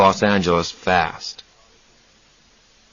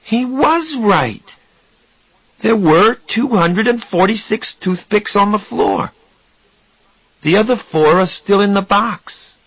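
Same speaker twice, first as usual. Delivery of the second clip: Raymond's voice; 11 semitones higher than usual. Acted. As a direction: recite the numbers like a fact, high and even. Raymond figure voice, counting.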